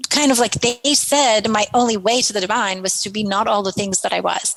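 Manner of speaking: normal talking voice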